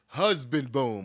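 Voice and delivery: slow, weighty honorific; playful proclamation. Pretend. Playfully bestowing titles on newlywed.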